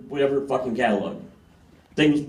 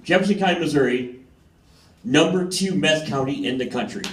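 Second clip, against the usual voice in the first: ordinary delivery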